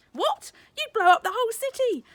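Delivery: high, loud outraged disbelief; shocked shout. A narrator's reading. Father aghast at his daughter's answer, loud and high. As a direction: nearly shout the question in alarm.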